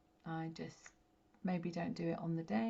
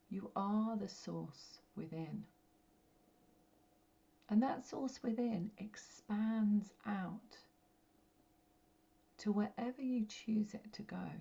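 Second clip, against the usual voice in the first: guided meditation. Real, not acted. Medium guiding listeners to connect with their light, meditative. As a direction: guide them gently inward, phrase by phrase, letting each settle.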